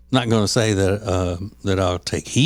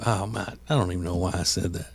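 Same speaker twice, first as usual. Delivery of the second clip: sheepish; 4 semitones lower, 8 dB quieter, faster than usual. Retold or imitated. Ace backing down, quoted.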